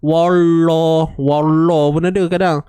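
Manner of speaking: Wallah with the tongue curled back; rolled tongue. Shown as a demonstration. Teacher imitates students' curled-tongue mistake. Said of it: "Lidah tergulung".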